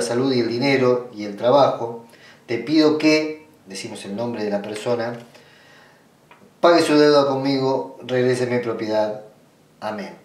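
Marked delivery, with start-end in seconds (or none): none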